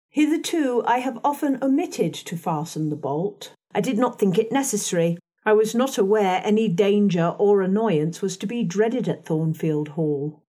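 ordinary delivery, nothing marked

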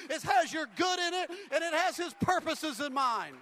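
impassioned pulpit shout; full-force preaching. Real, not acted. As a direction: preach it hard, shouting to the room.

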